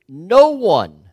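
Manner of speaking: loud, punched "No one."; emphatic. Acted. Preacher insisting to his flock, loud and emphatic. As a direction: drive it home to the congregation.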